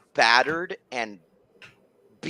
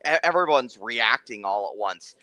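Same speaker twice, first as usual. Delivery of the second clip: normal talking voice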